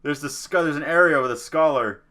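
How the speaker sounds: ordinary delivery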